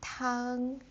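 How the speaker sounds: tang said high and flat, first tone. Shown as a demonstration. Teacher shows tang meaning soup, high and flat. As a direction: hold tang level and high. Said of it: "the first tone".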